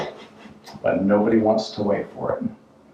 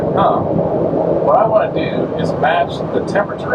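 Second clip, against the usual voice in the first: musing driver; 8 semitones higher, 3 dB louder, slower than usual. Retold or imitated. Imagined driver's idea.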